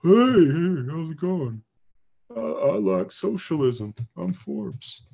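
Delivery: a hint of a swaggering pilot strolling in; mock-casual Maverick swagger; Forbes as Maverick. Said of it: "Forbes is gonna come in all Maverick".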